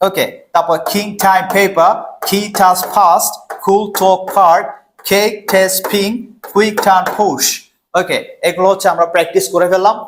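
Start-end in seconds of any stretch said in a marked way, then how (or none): 0.9-7.4 কিং, টাইম, পেপার onward in threes, puffed K, T, P; reversed triplets, each word crisply aspirated; combined pronunciation drill. Shown as a demonstration. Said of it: "word গুলো combine করে আমরা practice করব".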